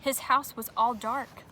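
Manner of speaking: ordinary delivery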